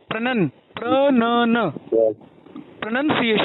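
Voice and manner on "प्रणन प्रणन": "प्रणन, प्रणन" drawn out very slowly, syllable by syllable; teacher spelling out the sound "प्रणन" slowly; slow pronunciation demo. Shown as a demonstration. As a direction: model "प्रणन" slowly for the student to copy. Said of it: "इसका उच्चारण लिखो आप".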